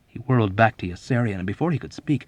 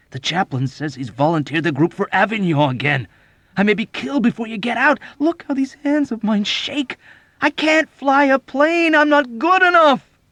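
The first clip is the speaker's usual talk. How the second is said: climbing to a high, frantic cry; desperate pleading; 14 semitones higher, 10 dB louder than usual. A narrator's reading. Frightened pilot begging his friend, building desperation. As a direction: plead louder and higher, near panic. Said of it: "Dobbs pleaded".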